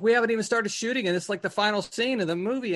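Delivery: can't believe the deadline, rushed and high; exasperated disbelief. Retold or imitated. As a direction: blurt it out in disbelief. Outraged at thirty-minute limit.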